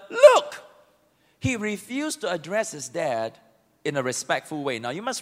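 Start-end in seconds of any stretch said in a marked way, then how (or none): 0.1-0.6 sharp, much higher shout; rude shout. Acted. Elder son rudely snapping at his father, loud. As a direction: bark it at your father. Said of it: "he answered his father".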